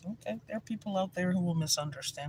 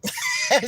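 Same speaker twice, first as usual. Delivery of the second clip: big real laugh bursting out; loud burst of laughter; 11 dB louder than usual. Real, not acted. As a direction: laugh out loud at your own joke. Speaker laughs at her comeback.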